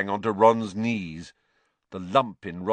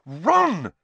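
higher, louder sharp rebuke; scolding a friend's name; 10 semitones higher, 6 dB louder than usual. Acted. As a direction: cut him off sharply. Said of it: "said Hermione sharply".

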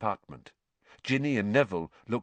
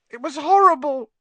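much higher and louder, shaken; 10 dB louder than usual. A narrator's reading. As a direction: push it up high, frightened boy. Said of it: "in a higher voice than usual".

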